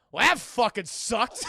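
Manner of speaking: slow, loud gruff complaint; gruff shouted gripe. Retold or imitated. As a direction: bellow it like a sore brawler. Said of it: "Aquaman's like".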